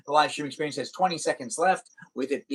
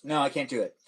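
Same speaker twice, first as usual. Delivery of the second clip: normal talking voice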